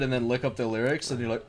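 normal talking voice

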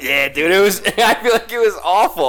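laughing through the words. Real, not acted.

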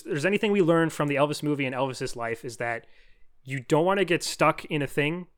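ordinary talk

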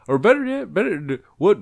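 usual speaking voice

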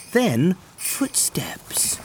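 hushed, slow and secret; whisper. A narrator's reading. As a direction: breathe it like a secret. Suspenseful whisper in story.

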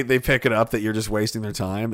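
ordinary talk

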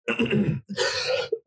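coughing, clearing a blocked throat; choking. Real, not acted. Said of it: "choking there".

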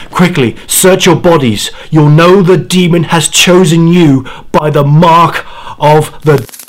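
high, loud and urgent; urgent warning. Acted. Dying grandfather to his granddaughters, urgent loud warning. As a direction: warn them with your last breath.

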